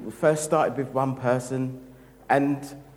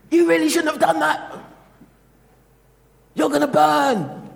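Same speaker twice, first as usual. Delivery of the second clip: much higher, louder, mocking scold with a laugh; mock harsh judge; 9 dB louder than usual. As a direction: sneer it like a smug accuser.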